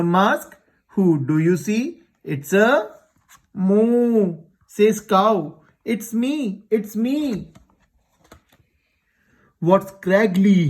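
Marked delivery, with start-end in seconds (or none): 3.5-4.4 long, raised storybook moo; drawn-out imitated cow call. A narrator's reading. 5.8-7.5 high, soft little voice; lightly voiced storybook cow. A narrator's reading. Cow reveals itself in story.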